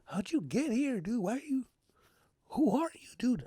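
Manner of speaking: high, soft, bewildered questioning; hammed-up startled whimper; mock frightened. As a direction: squeak it timidly at your dark double. Startled self questions mirror self.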